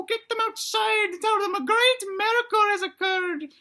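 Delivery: high, loud falsetto mother urging on; shrieky mock-motherly excitement; excited dinosaur mama. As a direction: send him off in a big high voice. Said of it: "And then Mama says".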